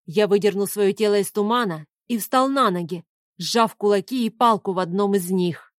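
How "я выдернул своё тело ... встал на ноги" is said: ordinary delivery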